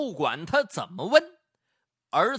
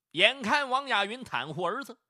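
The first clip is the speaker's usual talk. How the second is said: ordinary delivery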